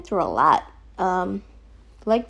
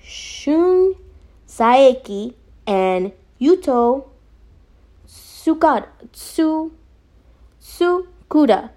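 Shun, Saeki, Yuto, Tsukuda drawn out one by one; slow, careful names; 3 semitones higher, much slower than usual. Shown as a demonstration. Reviewer retrying the creators' names, slow and careful. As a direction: sound out Tsukuda in slow pieces, pitched up. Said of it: "let me try this again, slowly".

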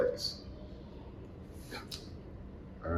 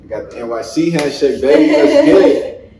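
loud laughing hype, pitched up; hype shout; 5 semitones higher, 13 dB louder than usual. Real, not acted. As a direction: hype up the crowd. Hyping the handshake.

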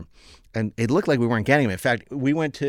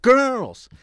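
Shatner voice; 11 semitones higher, 5 dB louder than usual. Retold or imitated. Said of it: "Shatner's answer".

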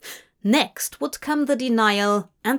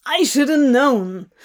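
bitter; 3 semitones higher, 6 dB louder than usual. A narrator's reading. Demon ending his rant.

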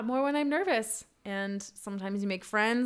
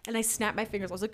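ordinary talk

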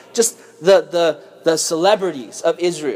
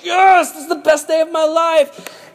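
much higher and louder, giddy cheer; thrilled kid; 10 semitones higher, 4 dB louder than usual. Retold or imitated. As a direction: crow with boyish triumph. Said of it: "I was just like".

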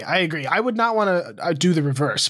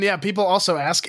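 ordinary delivery

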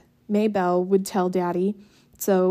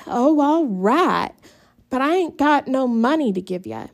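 weary mother; 5 semitones higher, 5 dB louder than usual. A narrator's reading. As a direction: give in with a sigh. Tired mother giving in.

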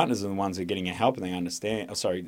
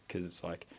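usual speaking voice

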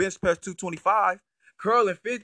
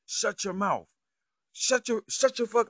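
imitating an angry outburst; 7 semitones higher than usual. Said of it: "he goes ape shit".

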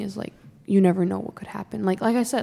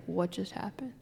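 said softly, in quiet disbelief; hushed replay of shock; stunned retold reaction; 11 dB quieter than usual. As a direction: drop the volume as if stunned by news. Retelling shock at trade news.

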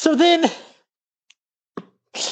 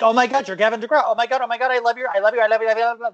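ordinary talk, nothing marked

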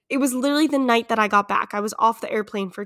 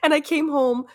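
giddy confession; 5 semitones higher, 7 dB louder than usual. Real, not acted. Laughing confession of boozy brunch.

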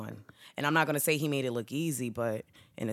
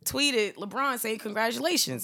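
mild disbelief; 9 semitones higher, 4 dB louder than usual. Retold or imitated. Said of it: "I was like".

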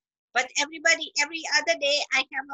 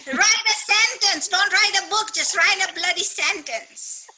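emphatic urging; 4 semitones higher, 3 dB louder than usual. Real, not acted. Urging listeners to just start.

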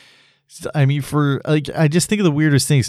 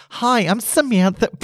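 high woman's voice; 11 semitones higher than usual. Pretend. Voicing a woman speed dater.

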